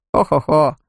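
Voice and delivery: jolly ho-ho-ho laugh. Acted. Shopkeeper chuckling.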